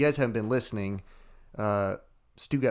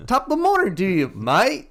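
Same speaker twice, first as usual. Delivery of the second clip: high, loud, fast Irish-Australian mashup; mixed mock accent; 11 semitones higher, 6 dB louder than usual. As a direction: toss in a jokey Aussie-Irish blend. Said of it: "Australian and Irish and English".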